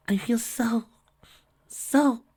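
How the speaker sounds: high whispered, faltering; weak high whisper; dry voice. Acted. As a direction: fade out mid-thought. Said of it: "she said in a dry voice".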